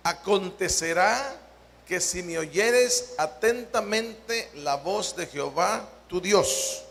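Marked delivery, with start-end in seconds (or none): none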